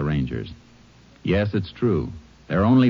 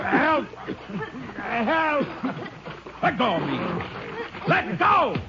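much higher desperate cries; drowning cries for help; 4 dB quieter, slower than usual. Acted. Fugitive who can't swim calls for help, desperate high cries. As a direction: gasp for help as you go under. Non-swimmer drowning in lake.

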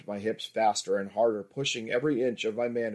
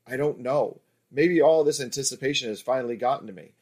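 plain reading voice

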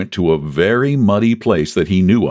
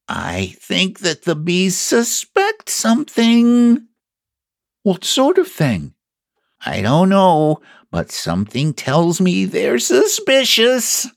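high, slow storybook bear calling down; conspiratorial Pooh; 12 semitones higher, slower than usual. Acted. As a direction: stretch out a secret warning in a high voice. Pooh calls down secretly from balloon.

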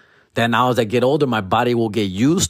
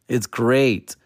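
normal talking voice

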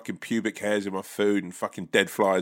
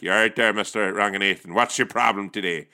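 Northern Irish call-centre agent squaring up; mock Northern Irish, toe-to-toe with the caller; Belfast accent. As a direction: put on an abrasive Belfast accent. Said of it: "they pass you over to Belfast".

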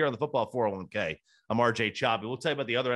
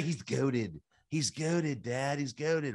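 softer, lightly voiced kid; a quiet hint of a kid insisting; imitating kid; 5 dB quieter, much slower than usual. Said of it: "That's what my kid would say".

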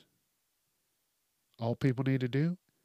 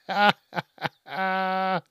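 high, drawn-out real laugh; hearty laugh; 5 semitones higher, 4 dB louder than usual. Real, not acted. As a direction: crack up at your own joke. Laughing at his own joke.

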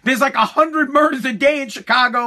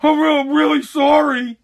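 higher, whimpering mock apology; scared teenage boy; 4 semitones higher than usual. Poor kid apologizing to the officer, tearful mock pleading. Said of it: "fucking poor kid that's, like, fifteen".

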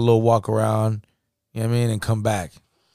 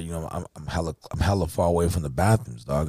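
normal talking voice

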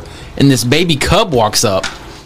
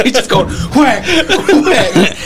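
laughing through it; 8 semitones higher, much slower than usual. Real, not acted. Imitating dad's whipping.

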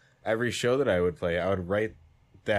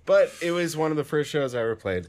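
usual speaking voice

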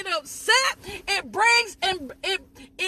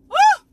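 a high, loud, ecstatic woo; joyful whoop; 10 semitones higher, 7 dB louder than usual. Real, not acted. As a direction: let the spirit burst out in a whoop. Preacher whooping mid-sermon.

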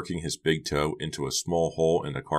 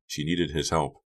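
plain reading voice

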